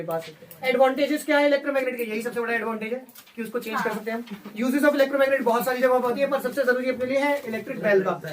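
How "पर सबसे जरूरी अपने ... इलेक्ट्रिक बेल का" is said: usual speaking voice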